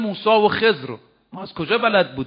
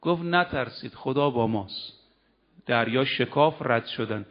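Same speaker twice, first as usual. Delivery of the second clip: hushed telling; 7 semitones lower, 6 dB quieter than usual. Acted. Moses reassures, narrated softly.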